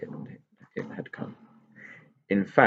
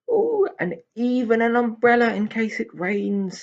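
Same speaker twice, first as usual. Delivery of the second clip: much higher, squeaky happy pixie; delighted pixie; 14 semitones higher, 5 dB louder than usual. Acted. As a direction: marvel at the umbrella. Tigger delighted umbrella.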